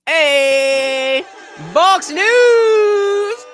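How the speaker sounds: high, loud, drawn-out call; loud hype shout. Pretend. Streamer hailing a donor on the stream, loud hype shout. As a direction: belt out the name and hold it.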